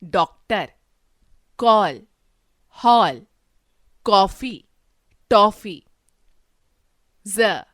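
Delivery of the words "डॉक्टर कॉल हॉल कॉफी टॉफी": डॉक्टर, कॉल, हॉल said louder with an open ऑ; borrowed ऑ sound. Shown as a demonstration. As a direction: lean on the open ऑ in कॉफी, टॉफी. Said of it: "ऑ". (अंग्रेज़ी वाला खुला ऑ, ज़ोर से)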